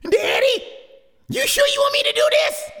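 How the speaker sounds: high, loud, quick worried plea; high frightened baby hawk; scared little chick. Acted. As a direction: beg Daddy in panic.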